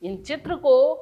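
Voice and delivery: ordinary delivery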